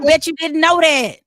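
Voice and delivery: loud, high rapper shout. Retold or imitated. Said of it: "my [name] impersonation".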